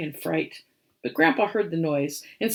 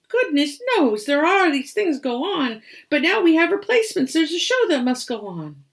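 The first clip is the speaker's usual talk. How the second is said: high, lively, a rush in the middle; lightly voiced young badger; 7 semitones higher, 4 dB louder than usual. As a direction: Birdie cheerfully offering replacements. Birdie speaks.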